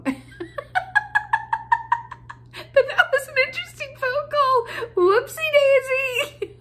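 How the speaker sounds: high, loud giggling; laughing high. Real, not acted. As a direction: squeal it out through laughter. Laughing at the mix-up.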